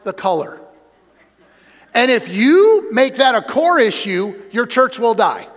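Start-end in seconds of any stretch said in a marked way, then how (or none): 1.9-5.5 louder, slow preached warning; slow it down and press the warning; deliberate preacher emphasis; pulpit warning. Acted.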